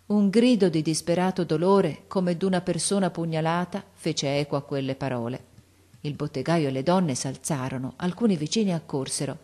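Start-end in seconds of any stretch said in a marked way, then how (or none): none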